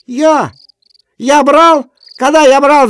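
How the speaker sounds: very high, loud squealing scream; high shrieking yell. A narrator's reading. Kuzma screams at Efrem, high squealing yell. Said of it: "закричал высоким, визжащим голосом".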